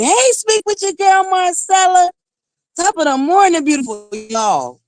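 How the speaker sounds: high, sing-song hello to the chat; bright greeting. Real, not acted. Host greeting her viewers in a high sing-song. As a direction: call out to viewers high and lilting.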